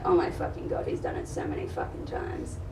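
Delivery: very low, soft creak under the breath; acted stunned undertone; hushed dawning realisation. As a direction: drop right down, as if it just hit you. Retold dawning realisation in the refuge.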